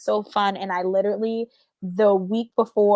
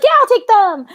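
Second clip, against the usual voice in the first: eager mother-in-law; 13 semitones higher, 6 dB louder than usual. Retold or imitated. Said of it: "she'd be like".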